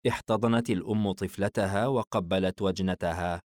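usual speaking voice

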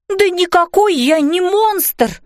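high, drawn-out indignant denial; cross. A narrator's reading. Fairy mum insisting she's no monster, cross. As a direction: protest crossly, high and stretched, a touch hammed-up. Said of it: "рассердилась мама".

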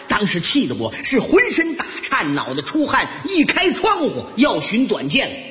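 normal talking voice

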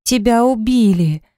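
anguished wail. A narrator's reading. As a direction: cry it out on the edge of sobbing. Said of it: "взвыла я и зашлась рыданиями". (с надрывом, сквозь рыдания)

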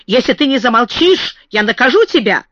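sudden high, exasperated scolding; flustered stern warning; threatening. A narrator's reading. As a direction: order a child to stop, peaking on the name. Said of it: "начинала совсем другим тоном".

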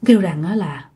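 ordinary delivery, nothing marked

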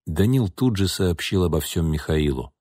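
ordinary delivery

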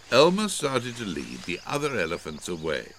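usual speaking voice

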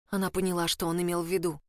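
ordinary delivery, nothing marked

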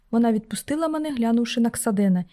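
plain reading voice

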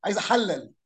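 أحلل said with standard Arabic vowels; classical vowels. Shown as a demonstration. Teacher corrects a student's أحلل to formal Arabic. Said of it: "هو يقول لك لا".

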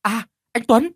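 high, loud relieved exclamation; woman greeting the rescuer, glad high cry; joyful cry. Acted. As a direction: cry out in relief as help arrives. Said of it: "Tâm đã liền reo lên".

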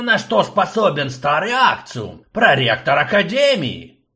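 put-on high, loud, fussy old professor; fussy old man. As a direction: play the fussy old rector. Old Akcium protests.

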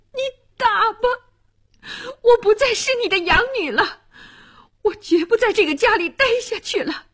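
high, impassioned defiance; impulsive outburst. A narrator's reading. Niece dares her aunt to hit her, defiant outburst. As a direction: let pent-up resentment pour out, pitched high. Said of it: "薇拉冲动地丧失了理智".

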